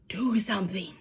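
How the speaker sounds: urgent whisper. A narrator's reading. The Nana whispering to Lucille's daddy. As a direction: hiss it under her breath. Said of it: "she said the words".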